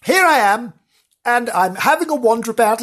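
a hint of a nervous ghost-hunter; spooky roving reporter. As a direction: tiptoe through the haunted theatre, half spooked.

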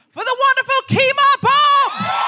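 loud, high, slow welcome call; announcer shout. Real, not acted. Host introducing the co-pilot with a loud high announce. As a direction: bring her on like a compère.

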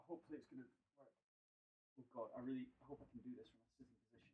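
distant, off-mic voice; far from mic. Real, not acted. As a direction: speak from across the room. Moving away from the microphone.

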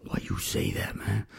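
hushed whisper, pointed question; confronting the sergeant in a whisper; whispered challenge. Retold or imitated. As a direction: whisper the challenge close to his ear.

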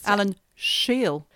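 slow "Alan Scheel" with a whistling sibilant; whistled S. Retold or imitated. Imitating Australia's premier gardener, "Scheel" said with a whistle. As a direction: draw out the "Sch" in "Scheel" into a little whistle. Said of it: "he had a little whistle when he talked".

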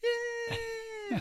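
very high, held squeal; mimicked crowd shriek; cheering crowd. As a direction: squeal it like a distant audience. Crowd screams reply, acted.

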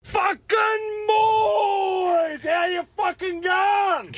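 shouting. Real, not acted. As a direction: bellow it like an excited caller. Caller shouting in on the phone.

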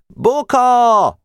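high, urgent calling shout; shouting from afar. A narrator's reading. Boy running to warn his leader, shouting from afar. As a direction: call the name across the lot. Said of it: "már messziről kiáltott".

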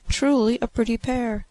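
high-pitched mocking glee; teasing exclamation. A narrator's reading. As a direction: jeer playfully at the couple in a high voice. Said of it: "laughing in their faces".

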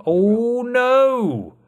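high, loud sudden dismay. Real, not acted. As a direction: cry out on realizing. Suddenly remembers forgotten segment.

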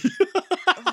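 loud high laugh; high burst of laughter. Real, not acted. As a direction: cackle at the joke. Laughing after dopey remark.

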